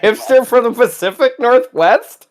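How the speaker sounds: high-pitched, hammed-up imitation of a friend; Brian impersonation. Co-host teasing his friend with his own voice, high mock imitation. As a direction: push the mimicry up high, exaggerated. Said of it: "I am not a talented impressionist".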